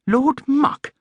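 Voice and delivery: surprised little bear; puzzled echo. Acted. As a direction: repeat it as a baffled question. Paddington baffled echo.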